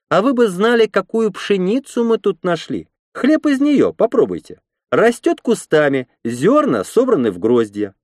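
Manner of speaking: high-pitched, eager woman's voice; red-haired woman, boastful. A narrator's reading. Red-haired woman boasts of wheat found here, proud high voice. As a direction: show off the harvest in a high voice.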